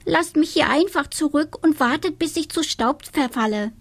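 high, melodramatic witch voice; little witch giving up dramatically; dramatic little witch. A narrator's reading. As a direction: moan it like a tragic little witch. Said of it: "meinte das Hexlein".

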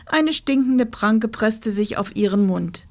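ordinary delivery, nothing marked

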